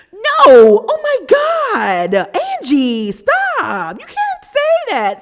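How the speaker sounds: high, loud scandalized outburst; mock outrage. Reacting to Angie's jab about renting, hammed-up scandalized shock. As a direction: shriek in hammed-up shock at the insult.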